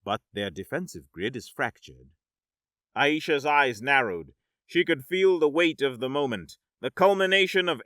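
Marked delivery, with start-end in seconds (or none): 0.0-2.1 emotionless mechanical robot voice, softer; flat machine readout, no emotion. Acted. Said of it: "its mechanical voice betraying no emotion".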